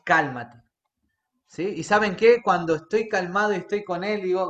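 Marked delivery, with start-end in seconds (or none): 0.0-0.6 a low, calm cálmate. Retold or imitated. Preacher voicing God telling him to calm, low. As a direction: say cálmate deep and slow, soothing. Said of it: "Y Dios me dice".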